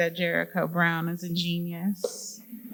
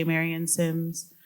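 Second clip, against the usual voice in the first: usual speaking voice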